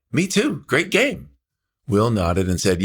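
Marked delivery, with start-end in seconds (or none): 0.1-1.3 put-on much higher girl's voice, chirpy question; teen girl. Cashier girl greets the boy in the car, high girlish voice. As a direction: voice her as a bright young girl.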